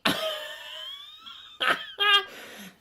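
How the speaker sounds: a very high shrieking laugh; a howl of laughter, almost a cry. Real, not acted. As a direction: scream with laughter. Bursts out at the comment.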